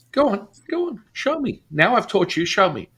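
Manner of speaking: high, goading challenge; high taunting voice. Retold or imitated. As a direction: egg him on, high and taunting. Teacher taunting him to perform.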